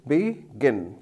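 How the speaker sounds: "begin" split into two separate syllables. Shown as a demonstration. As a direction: count out "begin" as be, gin. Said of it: "two syllables".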